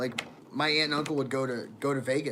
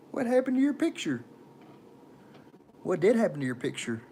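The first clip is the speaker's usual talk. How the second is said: silly put-on voice for a question; 5 semitones higher than usual. Streamer trying a voice on viewers, put-on voice. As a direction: ask it in a playful put-on voice. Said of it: "Y'all like that voice?".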